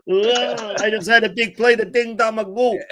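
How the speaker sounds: high laughing Italian New York voice; Italian New York accent. Retold or imitated. Mates joking about Mod in a laughing Italian New York accent. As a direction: ham up the mobster accent through laughter. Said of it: "Italian New York".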